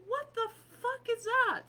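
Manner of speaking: high, loud baffled outrage; outraged disbelief. Retold or imitated. Reacting to being punished for old posts, loud high disbelief. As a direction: shriek it in disbelief. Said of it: "I'm like".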